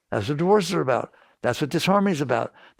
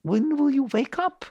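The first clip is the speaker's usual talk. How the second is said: much higher, pleading question; exasperated plea; 10 semitones higher than usual. Real, not acted. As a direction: throw it up high in exasperation. Teacher scolds listeners, exasperated.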